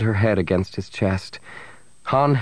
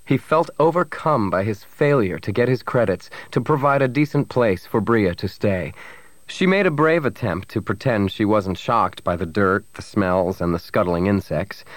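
ordinary delivery, nothing marked

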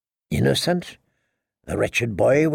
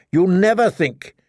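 higher, scolding woman's voice; berating queen; 6 semitones higher than usual. Acted. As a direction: scold him sharply. Cersei cuts him off.

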